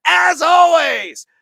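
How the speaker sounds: drawn-out, high, loud farewell yell; sign-off shout. Real, not acted. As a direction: hype up the closing line. Podcast sign-off, hyping listeners.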